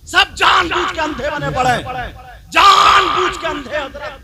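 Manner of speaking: loud, high, rebuking roar; angry shout. Real, not acted. Preacher rebukes the congregation, loud angry shout. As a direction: hammer the accusation home. Preacher scolds the willfully blind.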